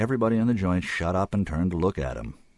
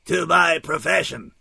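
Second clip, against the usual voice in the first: put-on slow, loud toast in an odd accent; high-pitched, oddly accented English; odd foreign accent; 10 semitones higher, 6 dB louder, slower than usual. A narrator's reading. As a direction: declaim it slowly in an unplaceable accent. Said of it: "said in oddly accented English".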